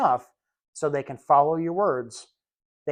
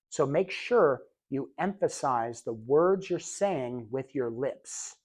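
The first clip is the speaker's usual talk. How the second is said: very slow, softer, over-mouthed words; exaggerated lip enunciation; 2 semitones lower, 4 dB quieter, slower than usual. Shown as a demonstration. Showing students lip-readable speech, slow and soft. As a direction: shape every word with the lips. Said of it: "See how I'm doing there?".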